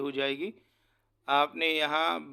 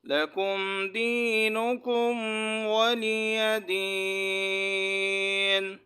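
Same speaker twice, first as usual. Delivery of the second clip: slow melodic tajweed chant, higher and louder, long held vowels; fluent Quran recitation; 5 semitones higher, 4 dB louder, much slower than usual. Shown as a demonstration. Teacher reciting the surah fluently for students to follow, melodic chant. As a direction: intone each verse slowly with long pauses between. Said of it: "ایک مرتبہ پھر آپ حضرات کی آسانی کے لیے دہراتا ہوں".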